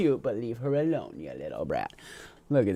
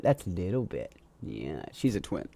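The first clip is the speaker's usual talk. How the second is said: ordinary talk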